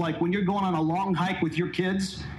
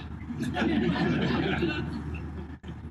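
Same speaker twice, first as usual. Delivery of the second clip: breathy laugh; 12 semitones higher, 4 dB quieter than usual. Real, not acted. Laughs at own poem.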